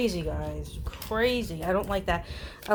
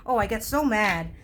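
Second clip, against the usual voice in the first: ordinary talk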